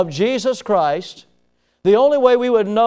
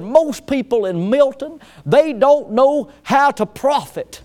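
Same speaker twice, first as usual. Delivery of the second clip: put-on country radio planner accent, pitched higher; 6 semitones higher than usual. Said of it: "it sounded just like that guy".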